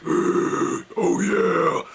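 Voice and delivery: gasping inward rasp; inhale growl. Shown as a demonstration. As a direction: growl "oh yeah" while breathing in. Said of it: "breathe inward".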